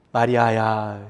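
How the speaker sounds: soft, gentle calling of her name; tender calling. Shown as a demonstration. Preacher softly echoing Jesus calling Mary. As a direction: say the name softly, like a loving shepherd. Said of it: "예수님의 음성을 알아들은 거예요".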